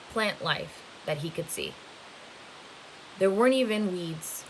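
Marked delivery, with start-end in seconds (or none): none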